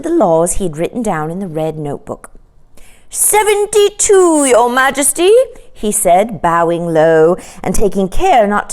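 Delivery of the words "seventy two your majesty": secretary's voice. A narrator's reading. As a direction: answer the king with a high bow. Secretary answers king.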